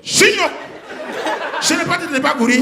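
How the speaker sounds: high sharp yell of a name; mock angry mum's shout; mother shouting. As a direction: call the child out angrily. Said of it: "sa maman vient frapper".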